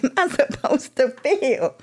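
high, slow bewildered question; baffled. Retold or imitated. Answering her sponsor, high slow bewildered question. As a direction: sound honestly lost, pitch way up and slow.

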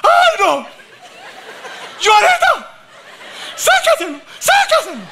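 high, loud mock panicked wife; imitating his wife. Husband imitating his wife at the dentist, high mock shriek. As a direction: shriek like your frantic wife. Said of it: "mi esposa empieza".

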